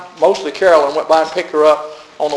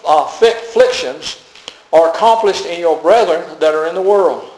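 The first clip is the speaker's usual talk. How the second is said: emphatic, weighty Bible reading; scripture reading; 2 semitones higher, 3 dB louder than usual. Acted. Preacher reading Peter's warning to the church, emphatic scripture reading. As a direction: read the verse out to the congregation, stressing key words.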